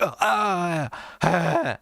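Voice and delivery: high, hammed-up moan of pleasure; mock climax moan, high and put-on. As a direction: moan it as a joke. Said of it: "and I was like".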